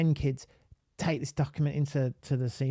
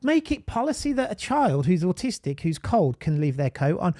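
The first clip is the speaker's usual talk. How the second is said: normal talking voice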